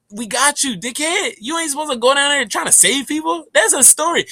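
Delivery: high, teasing, taunting voice; mocking being voice. Being taunting the speaker, high teasing voice. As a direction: tease him like a trickster.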